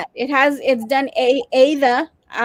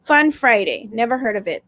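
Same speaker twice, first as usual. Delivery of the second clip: ordinary talk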